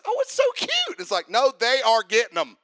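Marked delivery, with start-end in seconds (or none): 0.0-1.0 much higher, cooing parent voice; mock cooing. Preacher imitating parents cooing over a mermaid movie. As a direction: coo like a doting parent.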